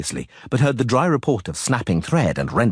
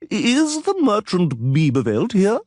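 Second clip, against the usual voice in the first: crowing; 11 semitones higher, slower than usual. A narrator's reading. Same official calling again.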